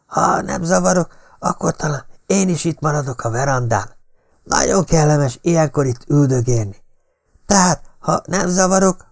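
put-on high-pitched, insinuating old man; screeching intruder. A narrator's reading. Drexler inviting himself onto the veranda, mildly screeching. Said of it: "rikácsolta Drexler".

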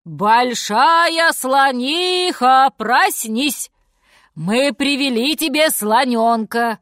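loud, slow, drawn-out call; shouted call. A narrator's reading. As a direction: call to wake someone far away. Lion calls to wake elephant.

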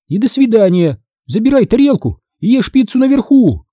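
high, exasperated dismissal; commanding. A narrator's reading. Mom banishes son upstairs with pizza, commanding. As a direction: send him away with a firm order. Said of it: "приказала мама".

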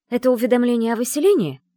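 high, loud, pointed question; urgent questioning. A narrator's reading. Secretary turning sharply on the house's owner, urgent. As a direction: fire the guess at them. Sharp guess at the scroll's contents.

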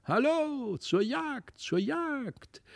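high, ringing hunting call; little hunters crying out for the chase, pitched high. A narrator's reading. Toy hunters calling everyone to the hunt, high and ringing. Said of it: "bliesen auf ihren Hörnern und riefen".

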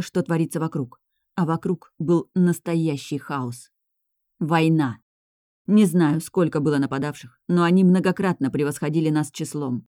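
ordinary delivery

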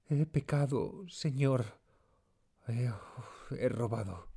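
pained, hesitant character voice; anguished confession. A narrator's reading. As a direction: confess haltingly, as if ashamed before God. Tearful servant confesses a theft.

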